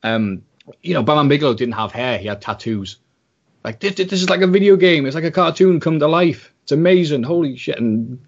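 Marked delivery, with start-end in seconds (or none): 3.8-7.8 excited. Retold or imitated. Child reliving first wrestling thrill.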